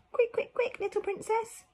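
quick, quick, quick high and urgent; excited high urging; urgent high call. Acted. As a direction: hurry her along, high. Urging princess in story.

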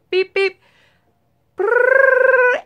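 high playful beep beep and trilled ring; voicing a phone call starting up; phone noise imitation. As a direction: mimic dialing then the ringtone. Imitates dialing and phone ringing.

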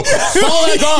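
a cab chase cliché, drawn out and laughing; slowly mocking the movie line in the cab. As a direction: ham it up like a movie passenger. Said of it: "You jump in a cab and go".

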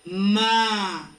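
"ma" stretched out for learners; one long, deliberate "ma"; sílaba modelo. Shown as a demonstration. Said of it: "me da la sílaba". ("ma" alargado, para que lo repitan)

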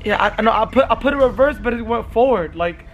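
mock-upset, stumbling explanation; faked distress. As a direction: act shaken and confused about the crash. Faking confusion after crash.

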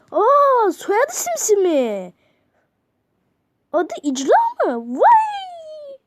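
high, loud slow surprise ending in a squealed Vay; mock astonishment. Reacting to the chatbot's name in mock surprise. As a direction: act amazed over the top.

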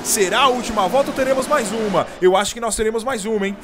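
rising live race call to a shouted finish; motorsport commentator. Real, not acted. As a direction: call the final lap at full excitement.

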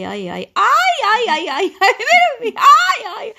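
high, loud ow ow cries as her feet are pressed; loud pained yelps. Real, not acted. Mother yelping as son presses her feet, loud and high. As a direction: yelp high and loud, half laughing.

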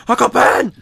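high, loud mock-desperate cry; hammed-up yell for a dying uncle; anguished movie shout. Imitating Tobey Maguire finding Uncle Ben shot, mock-anguished shout. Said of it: "and he's like".

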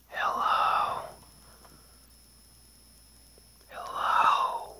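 a voiceless, eerie whisper; ghostly whisper. Retold or imitated. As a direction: breathe it like an unseen presence in the hall. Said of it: "he said it whispered again".

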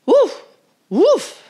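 high, loud yappy woofs; barking dog. A narrator's reading. Little dog barking at the school bus. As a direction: bark it like an eager little dog. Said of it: "barked Titus".

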